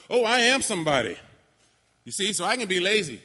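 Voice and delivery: loud, high, cocky bragging; puffed-up and pleased with himself; mock entitled youth. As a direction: play the smug entitled kid. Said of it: "We can get that attitude".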